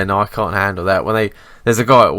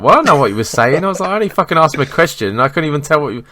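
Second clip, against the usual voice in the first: higher, louder, fast exasperation; flustered protest; 7 semitones higher, 5 dB louder, faster than usual. Retold or imitated. Telling coworker, fast flustered exasperated protest. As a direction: rattle it off in flustered defence. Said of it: "And I'm like".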